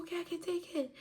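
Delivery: high, shaky-pitched okay; teary acceptance. Retold or imitated. Calming after yelling, high teary acceptance. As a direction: give in, still high and tearful.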